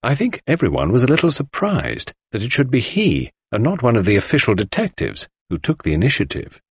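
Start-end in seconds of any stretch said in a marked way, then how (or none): none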